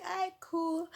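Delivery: high, breezy aight cool; casual high agreement; easygoing okay. Retold or imitated. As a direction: shrug it off brightly. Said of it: "We're like".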